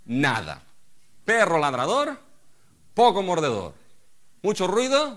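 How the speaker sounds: loud, high fiery sermon; fired-up pulpit proclamation; preaching at full force. Acted. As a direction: thunder it at the congregation. Preaching on David's anger.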